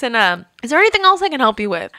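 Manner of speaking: usual speaking voice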